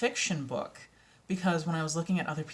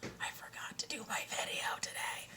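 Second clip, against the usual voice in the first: hushed, voiceless confession; retold whisper; 5 semitones higher, 11 dB quieter than usual. Telling partner about the forgotten video, acted whisper. Retelling sudden realisation to partner in bed.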